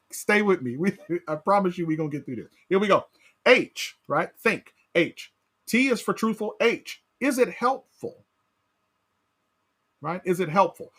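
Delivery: normal talking voice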